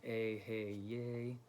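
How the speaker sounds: Ehyeh drawn out as "ay-hay-YAY"; name pronunciation demo. Shown as a demonstration. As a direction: say Ehyeh slowly, holding each syllable. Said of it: "put emphasis on that last syllable".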